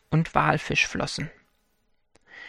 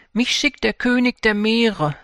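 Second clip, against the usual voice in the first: higher, louder talking turtle; 6 semitones higher, 3 dB louder than usual. Acted. Giant turtle to the fisherman, a higher messenger voice. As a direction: announce yourself as the sea king's envoy. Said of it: "sprach die Schildkröte mit menschlicher Stimme".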